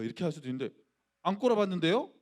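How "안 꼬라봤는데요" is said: louder, higher and provocative; cheeky defiance. Retold or imitated. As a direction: talk back to the senior with a rising challenge. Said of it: "이렇게 얘기를 했어요". (건방지게 대드는 말투)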